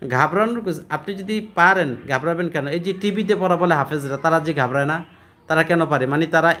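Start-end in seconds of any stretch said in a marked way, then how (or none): none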